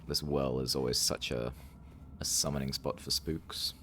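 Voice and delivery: ordinary talk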